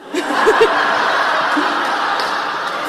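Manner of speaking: high, breathy burst of laughter; delighted airy laughter. Real, not acted. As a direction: crack up at your own punchline. Laughing at the punchline.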